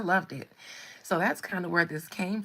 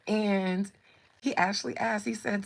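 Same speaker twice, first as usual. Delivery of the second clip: usual speaking voice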